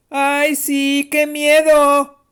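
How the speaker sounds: high, drawn-out sarcastic jeer; mocking yell. A narrator's reading. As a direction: jeer loudly as if terribly scared. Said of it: "les contestó a gritos Concepción".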